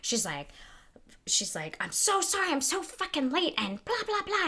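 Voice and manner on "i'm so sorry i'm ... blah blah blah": higher rushed apology; flustered late teacher. Retold or imitated. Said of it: "coming in and mumbling".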